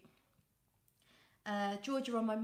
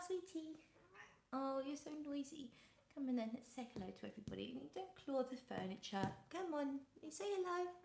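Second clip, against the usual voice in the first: talking to the cat; 3 semitones higher, 8 dB quieter, faster than usual. Real, not acted. Coaxing her cat on camera.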